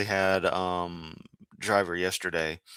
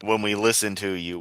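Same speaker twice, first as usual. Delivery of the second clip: ordinary delivery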